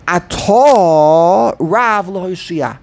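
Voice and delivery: slow, "Atah" drawn long as a-TAAH before stressed "Rav"; double accent. Shown as a demonstration. Teacher showing the problem, end-stressed "Atah". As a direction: stretch the end of "Atah", then hit "Rav". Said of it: "That's a problem".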